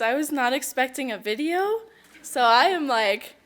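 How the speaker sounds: overwhelmed, pitch and volume jumping, laughing through it; trying not to cry. Real, not acted. Winner surprised by a tribute video, fighting tears. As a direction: laugh to hold back happy tears. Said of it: "trying not to cry".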